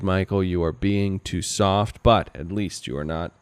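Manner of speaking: ordinary talk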